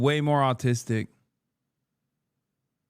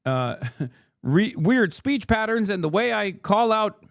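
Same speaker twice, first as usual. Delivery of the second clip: higher, lightly hammed newsboy announcer; imitating a newsboy announcer; 6 semitones higher than usual. Mocking a TV pundit's newsboy voice to friends, light ham. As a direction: play a pundit hamming up a transatlantic newsboy voice.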